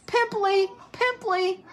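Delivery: very high jeering cry; high-pitched mocking call; taunting yell. A narrator's reading.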